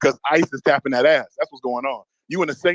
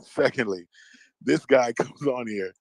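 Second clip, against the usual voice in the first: ordinary delivery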